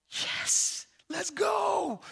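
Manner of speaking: breathy "yes" rising into a high cheer; hyped-up cheering. Retold or imitated. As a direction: cheer like a fired-up fan. Acts out reaction to film scene.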